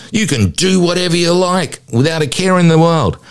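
high, bright mock prize announcement; game show announcer. Psychologist imitating a host announcing a jackpot, showy announcer voice.

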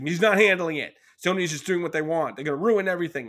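loud, high mock outrage; angry friend. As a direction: rant like a worked-up conspiracy theorist. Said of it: "he was just very angry".

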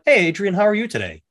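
sing-song overly cheerful phone greeting; cheery greeting. Retold or imitated. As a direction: greet the pharmacist with exaggerated friendliness. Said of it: "I'm like".

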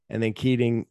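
ordinary delivery

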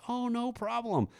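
the stranger brushing off thanks, high and breezy; breezy high lady voice. Retold or imitated. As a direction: toss it off high and easy.

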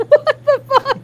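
very high, loud disbelief; loud, high-pitched retold shock; stunned reaction. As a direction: reenact shocked laughter at an insult. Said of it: "I was like".

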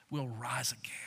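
Jesus whispering comfort to grieving Martha; whispered promise. Acted. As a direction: breathe the promise gently.

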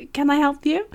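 surprised woman. Retold or imitated. Retold woman at door.

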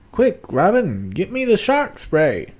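high, slow, loud superhero voice; urgent, hammed-up comic hero; campy Batman. Host playing Batman ordering Robin, high slow hammy voice. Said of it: "Talking like Adam West Batman".

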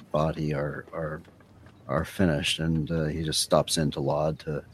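normal talking voice

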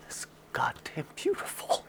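awed whisper; the chief whispers, overcome. Retold or imitated. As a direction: breathe it out, moved to tears. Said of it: "he goes".

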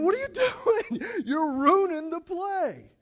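boss yelling again. Retold or imitated. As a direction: scold the actor in disbelief. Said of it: "The boss comes in".

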